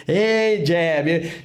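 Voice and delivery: imitated holler from the audience; mock audience shout-out; calling out.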